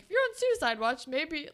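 harsh. Retold or imitated. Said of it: "he said something kind of harsh".

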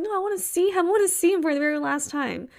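high, pleading retold protest. Daughter pleading with dad, tearful plea. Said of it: "And I was like".

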